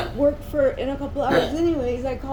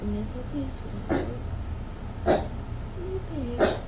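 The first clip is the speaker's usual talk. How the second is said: soothing pet voice; 6 dB quieter than usual. Real, not acted. Soothing a sick dog.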